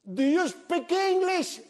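very high, drawn-out call; shouted question. Retold or imitated. Friend asking the man on the roof, shouted slowly. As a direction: stretch each word to carry upward.

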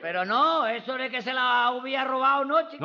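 loud, high-pitched denial; shouting in protest. Acted. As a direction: protest it at a near yell. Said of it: "no me grite".

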